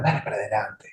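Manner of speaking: whispered pat-on-the-back encouragement; confiding encouragement. Acted. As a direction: drop to a sly, friendly whisper. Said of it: "viene con esa palmadita".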